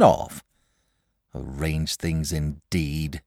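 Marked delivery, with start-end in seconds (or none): 1.3-3.2 slow, softer, mildly bitter muttering. A narrator's reading. Boy bitterly repeats the dragon's words to himself, slow and soft. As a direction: grumble it to yourself, sour. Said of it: "he said bitterly to himself".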